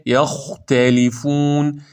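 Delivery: slow یختلفون, heavy خ then light "tæ"; drawn-out recited یختلفون; thin ت. Shown as a demonstration. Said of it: "تا نازکه".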